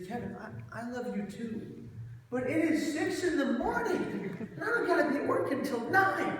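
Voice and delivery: high, laughing imitation of a sleepy father; a hammed-up sleepy father; groggy dad. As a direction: play the drowsy dad, amused and half awake. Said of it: "He goes".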